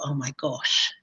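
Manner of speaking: hushed, low, stunned murmur; stunned inner thought. Retold or imitated. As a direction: let it slip out under your breath in shock. Inner thought at terrible news.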